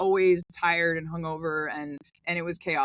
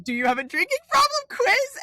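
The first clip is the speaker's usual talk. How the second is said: mock quiz title; 5 dB louder than usual.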